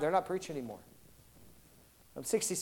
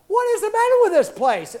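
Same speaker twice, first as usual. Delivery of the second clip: high, loud indignant question; baffled outrage; exasperated outburst; 12 semitones higher, 5 dB louder than usual. Retold or imitated. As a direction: throw up your hands at the place. Exasperated at gym rules.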